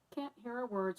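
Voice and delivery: ordinary delivery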